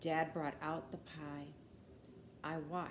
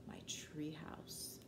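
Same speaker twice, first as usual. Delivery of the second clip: whisper; 4 semitones lower, 11 dB quieter, much slower than usual. A narrator's reading. Suspense whisper in story.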